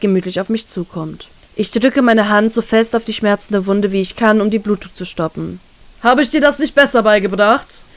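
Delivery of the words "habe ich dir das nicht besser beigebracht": scolding tease, called out; captain mocking his pupil, called out. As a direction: tease her for losing the fight. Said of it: "ruft er spöttisch".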